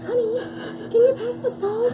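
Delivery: high, soft, giggly put-on voice; mock soft talker. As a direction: squeak it softly, fighting laughter. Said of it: "who talks like this?".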